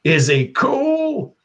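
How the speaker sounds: very high, slow is he cool; high questioning voice. Retold or imitated. Retelling a movie, a very high, slow question. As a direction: squeak it out, stretched and unsure. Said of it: "he was like".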